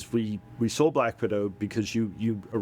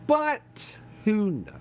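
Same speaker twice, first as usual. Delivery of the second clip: pitched way up and drawn out, slow; high shrugging question; 11 semitones higher, much slower than usual. Real, not acted. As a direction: float it up slowly, resigned. Shrugging end to complaint.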